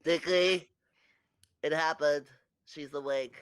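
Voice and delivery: loud, excited call to the captain; loud excited call: she's awake; excited shout. Acted. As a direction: burst in yelling the news. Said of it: "you just hear".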